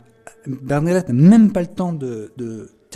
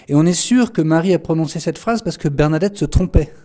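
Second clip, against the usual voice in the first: ordinary talk, nothing marked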